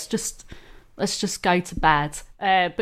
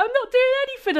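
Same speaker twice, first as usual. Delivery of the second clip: high mocking Peter voice; faster than usual. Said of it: "And then he was like".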